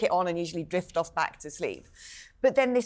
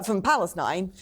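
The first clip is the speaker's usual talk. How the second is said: usual speaking voice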